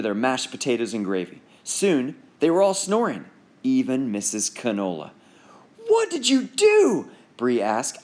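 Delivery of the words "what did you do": high, loud, slow gasp of a question; stunned disbelief; wide-eyed shock. Acted. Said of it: "eyes wide".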